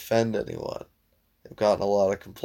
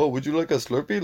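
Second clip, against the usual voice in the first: Apu impression; 4 semitones higher, faster than usual. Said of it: "Like, super".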